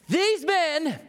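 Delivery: high shrill scandalized whine, slowed; hammed-up shocked complainer; outraged townsfolk. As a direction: gasp it like a horrified gossip. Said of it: "Don't you love it".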